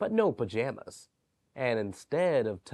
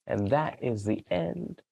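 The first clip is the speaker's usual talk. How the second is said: ordinary delivery, nothing marked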